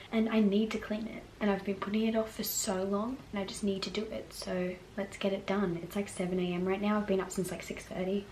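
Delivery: usual speaking voice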